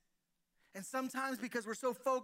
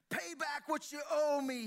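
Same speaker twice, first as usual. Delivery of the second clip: sharp, louder, higher demand; a harsh master demanding his money; 7 semitones higher, 5 dB louder than usual. A narrator's reading. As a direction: snap it like a creditor grabbing a debtor. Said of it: "he demanded".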